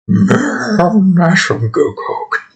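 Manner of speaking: a high whinny on "Man", then slow and whimpery; whimpering bear. Acted. A coked-up bear whimpering, whinny-like. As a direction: whimper the words like a horse's whinny. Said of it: "a whimper, like a whinny".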